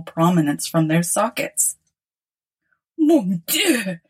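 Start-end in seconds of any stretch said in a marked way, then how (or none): 3.0-4.0 shocked cry; startled exclamation. Acted. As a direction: gasp it out in alarm. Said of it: "exclaimed the emperor".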